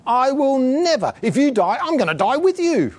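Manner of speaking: high, bold vow of loyalty; eager disciple vowing to die with his master; confident Peter. Retold or imitated. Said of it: "Really, really confident".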